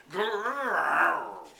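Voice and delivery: very loud long growl; angry growl. Acted. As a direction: roar it like furious tigers. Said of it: "could only say".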